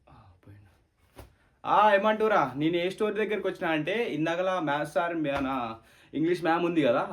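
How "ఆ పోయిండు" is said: soft whisper, almost silent; relieved whisper. Acted. Student whispers to classmates that the sir has gone. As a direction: breathe it out once he's gone. Relief after teacher leaves.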